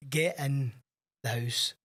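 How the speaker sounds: ordinary talk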